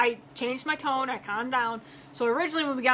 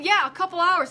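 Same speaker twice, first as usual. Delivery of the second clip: higher, louder, emphatic comeback; indignant retort; 6 semitones higher, 5 dB louder than usual. Retold or imitated. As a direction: push the words back at them, fed up. Angry retort, set against mock.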